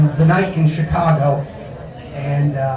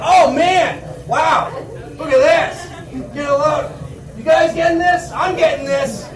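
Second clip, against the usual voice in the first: high, excited gawker; thrilled onlooker narrating his footage; gawking bystander; 9 semitones higher than usual. Retold or imitated. Bystander filming the beating.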